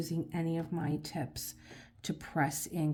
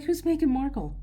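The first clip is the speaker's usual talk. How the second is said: husband voiced higher and louder, baffled; clueless husband; 9 semitones higher, 7 dB louder than usual. Husband asking who Meghan Markle is, baffled. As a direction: play him totally lost.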